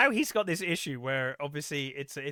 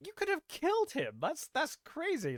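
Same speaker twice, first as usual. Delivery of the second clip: high, shocked correspondent voice; voiced correspondent; 12 semitones higher, 4 dB quieter than usual. Retelling a film, the correspondent gasps at the kick, high and shocked. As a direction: lift the voice high in mock alarm.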